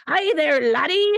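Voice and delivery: hammed-up, thick Scottish accent; forced Scottish accent. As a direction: overdo a bad TV Scottish brogue. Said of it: "really thick accents ... They're so forced".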